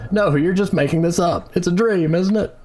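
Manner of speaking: high-pitched acted disbelief; upset denial. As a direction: plead that it can't be real. Reads EG in denial.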